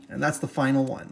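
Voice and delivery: normal talking voice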